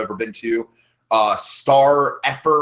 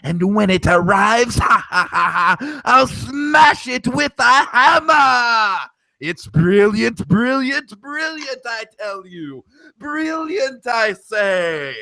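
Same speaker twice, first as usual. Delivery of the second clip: scheming villainess, whispers building to high cackling glee; Yzma impression; 6 semitones higher, slower than usual. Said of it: "can I do a Yzma monologue".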